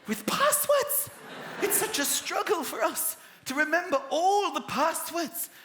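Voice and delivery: high, slow wistful voice; dreamy nostalgia. Shown as a demonstration. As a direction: drift off into memory.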